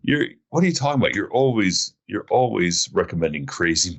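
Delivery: normal talking voice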